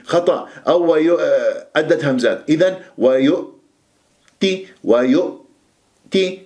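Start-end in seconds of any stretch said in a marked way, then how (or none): 3.0-6.4 ويؤتي slow, firm closed hamza; correct reading. Shown as a demonstration. Teacher showing students the correct ويؤتي. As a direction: hold the hamza still in ويؤتي. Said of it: "هكذا".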